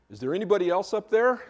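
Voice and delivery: looking for another way out; loud desperate call. Acted. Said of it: "And the man says".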